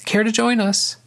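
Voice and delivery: very high-pitched, lilting invitation; high call to someone behind; calling over shoulder. A narrator's reading. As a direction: toss the invitation back, pitched right up. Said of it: "she called over her shoulder".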